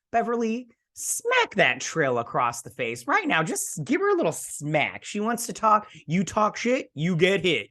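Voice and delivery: high, sassy trash talk with stretched words; sassy egging-on. Acted. Egging on Beverly to slap.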